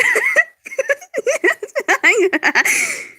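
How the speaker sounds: loud, high, prolonged laughter; helpless laughing fit. Real, not acted. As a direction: crack up uncontrollably. Laughing at the misspelling.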